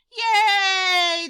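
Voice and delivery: loud high cheer. Acted. Crowd celebrating Big Bark's escape, loud cheer. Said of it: "the crowd cheered".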